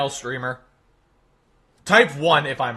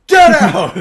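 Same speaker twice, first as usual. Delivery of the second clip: high, loud mock-outraged shout; mock heckling; 13 semitones higher, 11 dB louder than usual. Streamer booing own impression, mock heckler's shout. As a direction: heckle a bad act off the stage.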